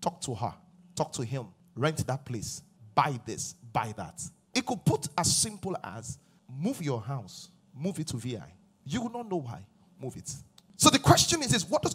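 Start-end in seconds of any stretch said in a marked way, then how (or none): 0.0-10.3 a hushed nudge from inside; gentle inner prompting. Retold or imitated. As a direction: lower and softer, like a quiet thought. Voices God's small thoughts.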